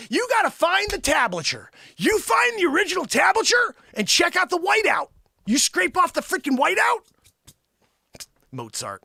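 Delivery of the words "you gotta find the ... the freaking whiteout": high-pitched, insistent shouting; hammed-up outraged insistence; indignant rant. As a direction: argue it hotly, pitched up. Indignant rant about stolen credit.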